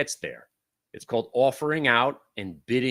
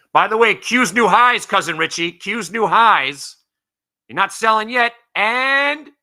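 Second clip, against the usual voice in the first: shouting much higher and louder, thrilled; excited shout; 9 semitones higher, 10 dB louder, slower than usual. Real, not acted. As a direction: call out the new highs to your trader with glee. New highs, calling to Cousin Richie.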